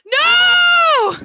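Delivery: drawn-out high howl of despair; wailing no. Acted. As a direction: howl it across the whole circus. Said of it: "Anton just wailing the word no".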